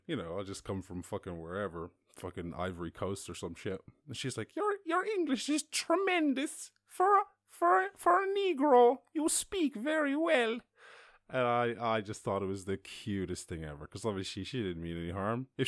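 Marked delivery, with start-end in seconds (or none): 4.5-10.6 put-on loud, slow, very high old-lady voice; old woman. Old woman complimenting a stranger's English, high mock voice. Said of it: "this very old woman next to me".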